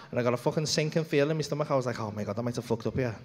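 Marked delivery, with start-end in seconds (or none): 2.0-3.2 low, hushed dismay; softly horrified at his own mistake; quiet dread. Retold or imitated. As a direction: let it sink to a low, soft admission. Retells his sinking feeling.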